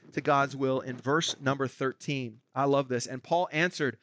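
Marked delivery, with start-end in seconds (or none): none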